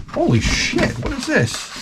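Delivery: stunned. Real, not acted. Stunned by the folder.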